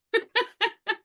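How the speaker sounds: short laugh pitched far up; a quick high laugh of delight. Real, not acted. Mother delighted at her son asking for a twenty, high laugh.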